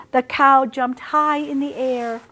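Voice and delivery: ordinary delivery, nothing marked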